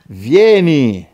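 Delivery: loud, drawn-out scriptural cry; full-voiced call to come; shouted response. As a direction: belt it out as a summons. Repeats verse, shouting the response.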